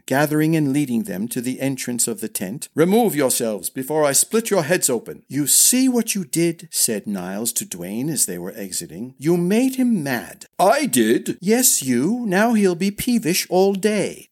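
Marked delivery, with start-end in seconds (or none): 2.7-5.2 higher-pitched exasperated threat; fed-up, commanding; angry order. A narrator's reading. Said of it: "cried Dylan". 5.3-6.7 high-pitched blame; higher, reproachful question; accusing. A narrator's reading. Niles blames Duane, bickering. 9.2-14.3 high-pitched squabbling; indignant, raised-pitch back-and-forth; bickering. A narrator's reading. Two brothers bickering over blame.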